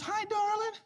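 put-on high sweet old lady voice; high grandma greeting. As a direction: greet like a doting granny.

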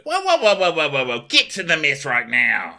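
high, loud string of whoas into a command; mock-alarmed yelling; hammed-up shouted order. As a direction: imitate someone frantically blocking the way. Acting Daisy ordering him out.

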